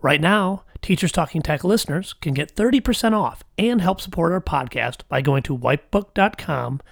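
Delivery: bright podcast ad voice; ad read. Acted. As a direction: sell it like a radio spot. Host reads sponsor ad.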